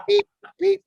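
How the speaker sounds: loud, high-pitched imitated beeps; squeaky electronic beep, done by mouth; robot beeps. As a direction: beep like a glitching machine. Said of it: "It's a malfunctioning robot".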